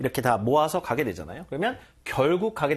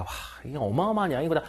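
mock amazement; much faster than usual. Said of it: "이런 얘기 하잖아요".

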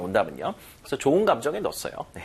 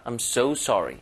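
so pressed a little harder than sorry; stress on so; much slower than usual. Shown as a demonstration. Teacher models stress on so for learners. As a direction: lean lightly on so, not on sorry. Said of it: "강세가 어디 들어가요?".

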